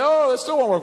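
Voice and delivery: put-on a fed-up kid whining that nothing works; whiny kid. Kid complaining a third time.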